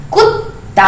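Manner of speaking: कुत्ता with the half त clearly stopped; demo word. Shown as a demonstration. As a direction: say कुत्ता with a short stop in the middle. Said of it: "देखिए". (कुत्ता आधे त के साथ)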